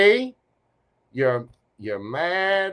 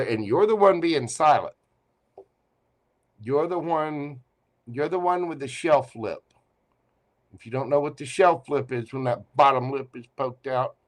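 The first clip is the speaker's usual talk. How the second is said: ordinary talk, nothing marked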